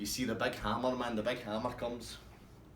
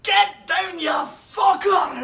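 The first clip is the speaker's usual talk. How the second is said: very high, very loud, slow drawn-out bellow; Big Sargy bellowing at the bar, full scale; furious roar; 15 dB louder, much slower than usual. Retold or imitated.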